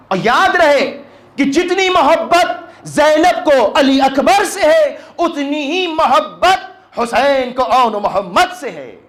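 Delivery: loud, high, drawn-out proclamation; impassioned majlis preaching. Acted. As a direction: proclaim it with slow, rising fervour. Preaching at full force.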